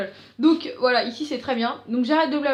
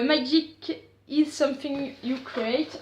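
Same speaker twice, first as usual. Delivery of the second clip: slow English read with a strong French accent, "Magic is something you create"; much slower than usual. Real, not acted. Reading the box label to her viewers in a slow French accent. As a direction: sound out the English slowly, thick French accent. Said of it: "vous remarquerez encore mon accent".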